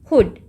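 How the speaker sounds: हुड cut short; a brief vowel in हुड, over at once. Shown as a demonstration. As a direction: say हुड with a quick oo. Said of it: "जल्दी से ख़त्म हो गया".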